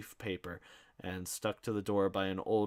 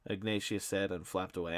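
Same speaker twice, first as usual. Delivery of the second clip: ordinary delivery, nothing marked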